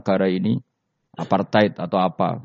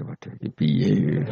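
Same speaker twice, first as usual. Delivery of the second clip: slow, laughing "oh no, what now"; slow giggling dismay; laughing mock dismay; 3 semitones lower, slower than usual. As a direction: play the angel stumped while giggling. Munkar Nakir bewildered, laughing.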